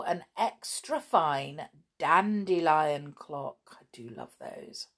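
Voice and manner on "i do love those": very low, soft confiding murmur; near-whisper to the listener; hushed aside. Acted. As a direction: drop right down as a private comment. Hushed personal aside mid-story.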